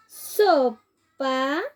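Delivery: SOPA stretched into two long beats, So and pa; drawn-out word presentation. Shown as a demonstration. Teacher introducing sopa to children, drawn out by syllables. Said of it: "Vamos, con la primera".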